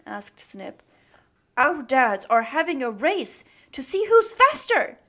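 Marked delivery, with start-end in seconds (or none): 1.5-5.0 loud, high, bubbling with excitement; giggly excitement. A narrator's reading. Beaky telling Snip about the race, giggly and excited. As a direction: burst out with the exciting news. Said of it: "giggled Beaky".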